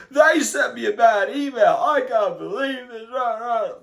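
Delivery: loud, high mock meltdown; screaming tantrum. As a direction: wail and shout like an office worker throwing a childish fit. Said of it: "hitting the keyboard and screaming".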